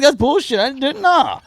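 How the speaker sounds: hammed-up indignant scoff; mock outraged. Retold outburst at doctor.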